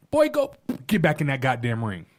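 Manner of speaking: ordinary talk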